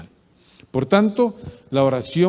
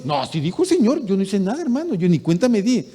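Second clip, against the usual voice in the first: higher, louder mock innocent excuse; 7 semitones higher, 5 dB louder than usual. Fake prophet excuses himself to the brother, mock innocent pleading. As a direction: play the fake prophet dodging blame. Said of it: "Oh, sí, dice el Señor".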